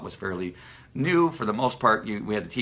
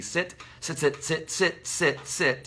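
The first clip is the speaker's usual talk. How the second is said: nagging repetition; 8 semitones higher, much slower than usual. Retold or imitated. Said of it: "They say".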